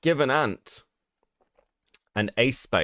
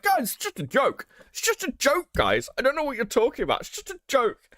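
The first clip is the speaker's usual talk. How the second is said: much higher, put-on mocking voice; mocking whiny excuse-maker; 12 semitones higher than usual. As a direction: mimic their excuses in a high pitch. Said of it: "be like".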